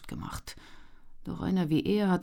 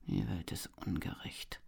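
whispered under the breath, much softer; murmured; 6 semitones lower, 12 dB quieter than usual. A narrator's reading. Young man grumbling alone over his stew, whispered. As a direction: mutter it bitterly to yourself. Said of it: "murmelte der junge Mann".

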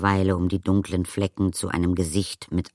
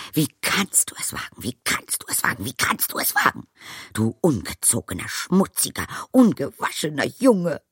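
scandalised rant, voice pitched up; tearful outrage; 8 semitones higher than usual. A narrator's reading. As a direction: scold him, close to tears. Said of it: "Sie war den Tränen nahe".

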